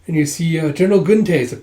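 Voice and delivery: usual speaking voice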